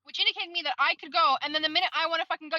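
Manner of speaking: high-pitched, exasperated rant; heated mock confrontation; indignant. Retold rant at another driver.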